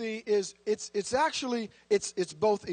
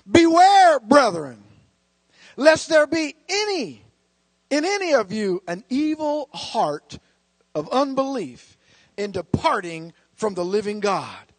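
thundering scripture reading; 7 dB louder than usual. Acted. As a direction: declaim it like a warning from the pulpit. Reads scripture as proclamation.

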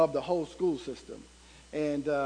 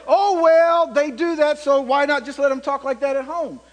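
mock dismissive excuse; 7 semitones higher, 10 dB louder than usual. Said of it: "doesn't mean I'm gonna say".

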